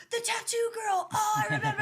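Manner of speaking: sudden delighted remembering; excited recognition. Retold or imitated. Imitating Jerry recognizing the tattoo girl, excited.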